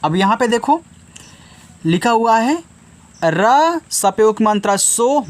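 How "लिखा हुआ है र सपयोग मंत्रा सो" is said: usual speaking voice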